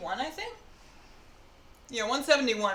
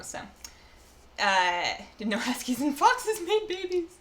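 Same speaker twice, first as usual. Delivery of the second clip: louder, upbeat sign-off to viewers; stream outro; 3 semitones higher, 4 dB louder than usual. Acted. Streamer thanking viewers and signing off, upbeat and louder. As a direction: wrap up the stream brightly to chat.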